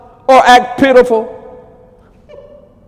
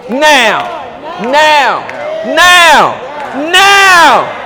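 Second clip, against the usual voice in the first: building shout; 4 semitones higher, much slower than usual. Real, not acted. Preacher demanding arrival now, building to a shout.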